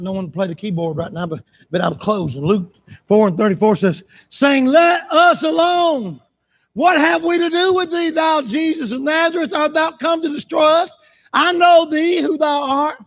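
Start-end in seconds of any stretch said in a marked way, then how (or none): 4.3-13.0 high, slow shouted scripture; full-force preaching. Acted. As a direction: cry out the demons' plea from the pulpit.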